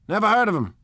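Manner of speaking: blunt and dismissive; decisive. Acted. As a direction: brush it off firmly. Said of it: "he remarked decisively".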